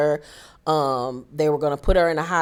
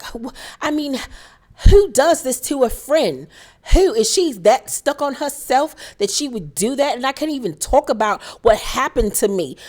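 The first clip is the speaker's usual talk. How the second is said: mocking Dorit; 6 semitones higher than usual. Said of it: "she was like".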